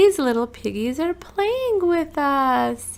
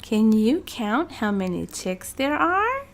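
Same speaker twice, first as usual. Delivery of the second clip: ordinary delivery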